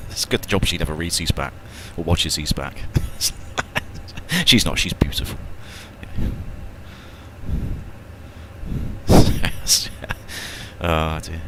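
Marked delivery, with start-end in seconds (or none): 2.8-4.4 breathy, voiceless chuckle; helpless chuckle at his own joke; laughing. Real, not acted. Joking about his wife's looks. 5.4-10.8 long breathy laugh. Real, not acted. Laughing at his own joke.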